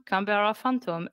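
usual speaking voice

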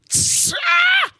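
sharp hissed tss then a high shouted ah; jolted cry at a lightning flash; startled shriek. Retold or imitated. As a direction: mimic the lightning jolt. Imitated lightning crack startle.

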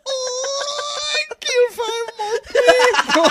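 crying. Retold or imitated. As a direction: howl it in exaggerated sobs. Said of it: "He just started crying".

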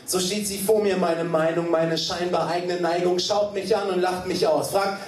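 driving rhythmic spoken word; poem with momentum; slam poetry recitation. Acted. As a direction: recite briskly on the beat.

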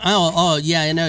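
usual speaking voice

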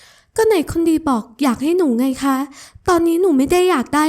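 put-on high, wheedling young woman's voice; coaxing sweet girl. Ramon wheedles Adisa to stop bidding, high and sugary. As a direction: sweet-talk her out of it, voice up high.

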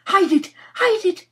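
high, loud, urgent commands; frantic. Acted. Nora ordering the boy to hide evidence, frantic. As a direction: panic rising on the second cry. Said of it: "said Nora frantically".

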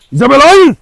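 high loud no; yelling to stop a lunging dog; shouting at the dog. Real, not acted. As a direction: shout her name, then a hard no. Stopping dog from attacking.

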